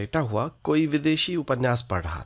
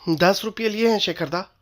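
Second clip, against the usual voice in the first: higher, slightly raised call; a bit raised voice; 8 semitones higher than usual. A narrator's reading. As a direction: raise the voice to catch his attention. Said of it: "कुछ ऊंचे स्वर में बोली".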